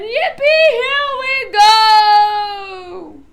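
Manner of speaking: high, loud, stretched-out whoop; excited shout. A narrator's reading. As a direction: let it ride all the way up. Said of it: "shouted Grandpa Joe again".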